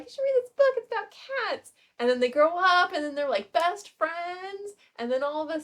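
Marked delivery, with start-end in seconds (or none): 0.0-1.7 lightly voiced eager little girl, higher; a hint of an earnest kid recommending; niece's voice. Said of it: "She's like".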